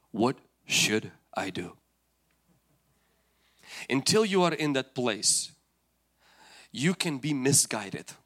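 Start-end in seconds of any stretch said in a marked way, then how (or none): 0.1-1.8 low, slow, deliberate question; measured prayer. Acted. Believer asks the Lord once renewed, low and slow. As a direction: space the words out and let them settle.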